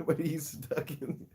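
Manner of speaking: real amused giggling; laughing while talking. Real, not acted. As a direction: try to explain while still laughing. Laughing at stuck pet.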